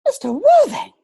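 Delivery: a high, loud cry of surprise at his name; startled exclamation. Acted. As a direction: call out his name in sudden shock. Sees Jack arrive in mourning.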